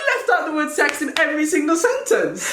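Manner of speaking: high, laughing disbelief; incredulous laughter. Real, not acted. Co-host amazed Siri left a word out, laughing. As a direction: crack up while getting the words out. Both crack up at Siri's omission.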